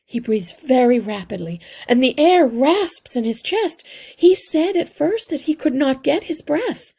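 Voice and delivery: high, loud, hurried and close to panic; frantic mother mouse. A narrator's reading. Mother mouse telling the healer her son is delirious, high and panicked. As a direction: pour out the fear for your sick child. Mother describes son's breathing.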